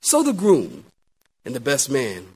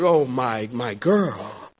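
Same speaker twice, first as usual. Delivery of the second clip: lower, lightly voiced proud mother; a hint of tender motherly pride; bride's mother; 7 semitones lower, 3 dB quieter, slower than usual. Bride's mother admiring her daughter, lightly voiced tenderness. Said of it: "the bride's mother".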